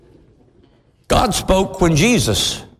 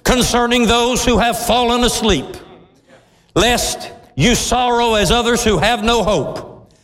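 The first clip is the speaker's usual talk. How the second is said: scripture reading; 6 semitones higher than usual. Acted. Preacher reads Paul's letter to congregation, fervent and raised. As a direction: read it like a proclamation.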